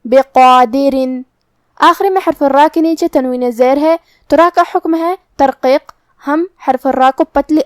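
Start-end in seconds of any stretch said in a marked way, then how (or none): 0.1-1.2 بقادرن with a heavy, full qaf and long alif. Shown as a demonstration. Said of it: "دونوں کو بھاری آواز میں پڑھیں گے". (بقادرن بھاری آواز میں)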